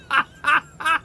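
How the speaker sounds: helpless high-pitched laughter. Real, not acted. Reviewer recalling Billy Blanks fighting, laughing hard. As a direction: burst out in a high cackle.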